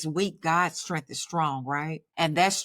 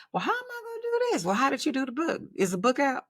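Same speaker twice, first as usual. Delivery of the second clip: much higher and fast, squeaky fluster; mock panic; 8 semitones higher, faster than usual. Retelling her panicked answer to God about the movie, high, fast fluster. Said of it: "I'm like".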